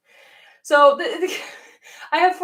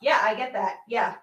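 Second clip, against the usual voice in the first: ordinary talk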